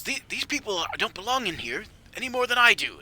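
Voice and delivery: high, loud prisoner's complaint; acted indignant insistence; indignant protest. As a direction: protest loudly to the guard.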